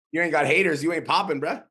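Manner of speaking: high, loud hype; hyped up. Real, not acted. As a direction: pump him up with energy. Hyping up a student.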